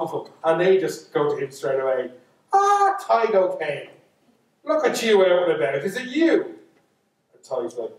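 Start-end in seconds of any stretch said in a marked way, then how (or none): 2.5-6.6 taunting fairy greeting. Acted. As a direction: call out as a fairy who has caught someone. Fairies hail him straight away.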